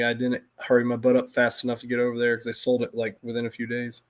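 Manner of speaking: ordinary talk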